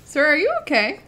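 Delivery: high, loud teasing question; mock concern. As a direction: ask him if he's okay, teasing. Mocking concern at glitching character.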